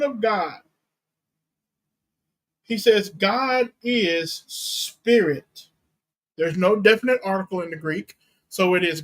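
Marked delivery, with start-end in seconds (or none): 3.1-5.7 very slow "God is", then "spirit" set apart; emphasis on SPIRIT. Shown as a demonstration. Preacher giving Jesus' description of God, slow emphasis on "spirit". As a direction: stretch each word, landing on "spirit". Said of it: "the emphasis is on God is spirit".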